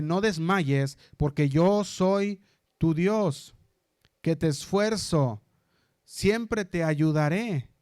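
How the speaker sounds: slow, solemn reading of God's words; preacher voicing God's promise; slow scripture reading. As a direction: let each promise land, slowly. Reads God's promise from Isaiah.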